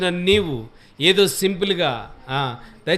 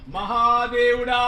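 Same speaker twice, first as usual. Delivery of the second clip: worshipper crying out; 6 semitones higher, slower than usual. Retold or imitated. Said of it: "ముఖం నేల మీద పెట్టేసి".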